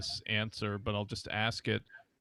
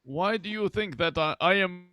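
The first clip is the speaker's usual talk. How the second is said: lightly imitated Central or Eastern European accent; foreign accent; 8 semitones higher, 9 dB louder than usual. Retelling a girl's oddly arranged English, imitated European accent. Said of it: "It's funny how".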